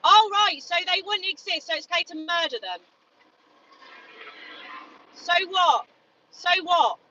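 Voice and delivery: furious retorts thrown back at him; angry shouting. Real, not acted. Activist arguing with a passer-by, furious shout. Heated argument with meat eater.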